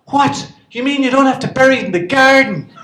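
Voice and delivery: fooling the host about fridges, hammed-up amazement; mock astonishment. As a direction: ham up being amazed. Feigning amazement.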